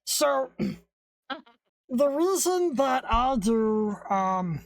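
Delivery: slowed, warped alien voice filter. Real, not acted. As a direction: talk through an alien voice effect. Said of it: "you can have the alien voice for one minute".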